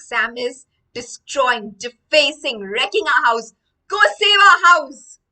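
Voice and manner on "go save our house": loud, high rallying shout; urgent command. Acted. William sending the baby to save the house, urgent shout. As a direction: send the champion off to the rescue.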